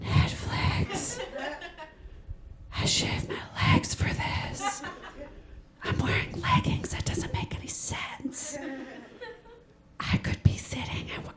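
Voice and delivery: high, hushed, pleading whisper; urgent whisper. Pretend. Audience thinking of home during the set, pleading whisper. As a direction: murmur it desperately under your breath. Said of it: "you were like".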